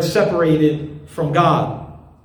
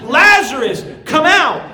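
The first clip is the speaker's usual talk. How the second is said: commanding call to the tomb, louder; 9 semitones higher, 5 dB louder than usual. Acted. Jesus at the tomb calls Lazarus out, in a commanding shout. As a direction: call a dead man out of his grave. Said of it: "Jesus cried out in a loud voice".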